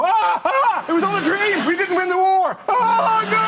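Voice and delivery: hammed-up horror, shouting at the end; mock anguished Brit waking. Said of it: "wake up and go".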